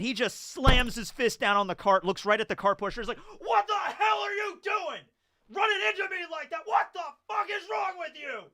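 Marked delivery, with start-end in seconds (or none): 3.4-8.5 high, strained mock-ranting shout; imitated furious yelling. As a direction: act out a man blowing up at someone. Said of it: "he's just yelling and screaming".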